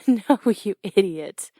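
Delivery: loud, drawn-out fond insult through laughter; laughing teasing. Acted. Coach laughing at student who took the punch, teasing. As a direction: burst out laughing, then slowly scold.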